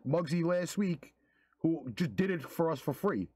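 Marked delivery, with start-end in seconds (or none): none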